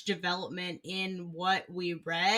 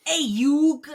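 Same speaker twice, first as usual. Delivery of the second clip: high cartoon Brooklyn greeting; louder, hammy Brooklyn drawl; Brooklyn accent; 7 semitones higher, 9 dB louder than usual. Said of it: "a bad Brooklyn accent".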